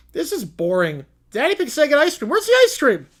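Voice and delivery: rising to a high, loud demand; spoiled piglet demanding ice cream; bratty piggy whine. Acted. Peppa complaining on the float about ice cream. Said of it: "Peppa's snorting".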